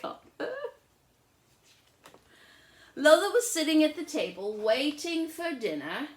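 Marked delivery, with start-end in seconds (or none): 2.9-6.1 louder, lively read-aloud voice; bright storybook reading; story narration. A narrator's reading. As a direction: read it out like a picture book to a child. Reads the story.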